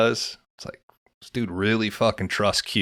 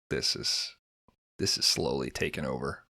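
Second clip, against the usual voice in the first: hushed, much softer, low, half-whispered; awed murmur to oneself; hushed aside; 6 semitones lower, 7 dB quieter than usual. Retold or imitated. Musing while watching Fallout.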